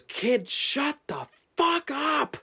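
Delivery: very high, drawn-out mock yell; imitated angry scream. Grown man turning round on a junior high kid, imitated scream. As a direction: scream it slowly at a heckling kid. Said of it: "this guy starts screaming".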